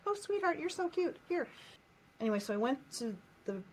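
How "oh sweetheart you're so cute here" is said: put-on high, cooing voice to a little kid; sing-song, high-pitched fawning; doting grown-up. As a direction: gush over an adorable child. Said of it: "everyone would be like".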